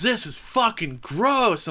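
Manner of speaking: high, slow, disgusted whine; mock outraged complaint. Angry diner complaining loudly about a hair. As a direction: drag out the disgust like a diva. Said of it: "making a big scene".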